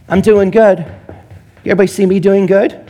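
mock someone begging for approval; mocking show-off. Parodying people seeking validation, loud preening boast. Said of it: "we look to the outside".

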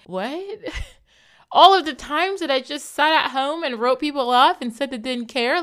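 high, loud, laughing in disbelief. Real, not acted. To listeners, laughing at how she wrote people off.

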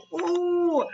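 high, drawn-out mock "ooh"; amused imitation of a high coo; playful "oh". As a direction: let out a long, high-pitched put-on "oh". Imitated reaction after laughing.

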